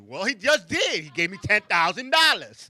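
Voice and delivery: loud, slow gleeful punchline. Real, not acted. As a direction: crow about the free money. Gleeful punchline about keeping money.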